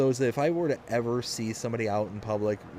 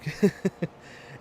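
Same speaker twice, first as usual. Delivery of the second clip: laugh; 7 semitones higher than usual. Real, not acted. Laughs after joke.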